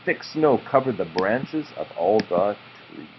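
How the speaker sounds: plain reading voice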